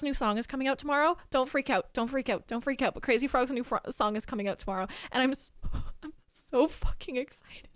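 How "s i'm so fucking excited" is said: breathless giddy whisper with a laugh; whispered thrill, barely holding in a laugh; excited whisper. Real, not acted. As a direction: hiss out the excitement like a secret. Giddy over new frog song.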